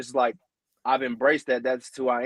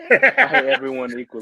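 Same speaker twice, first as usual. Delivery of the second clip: loud, high, laughing through the line; laughing movie quote; 12 semitones higher, 7 dB louder than usual. Retold or imitated. Hamming up the movie line for the guys, laughing loudly. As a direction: belt the quote while cracking up.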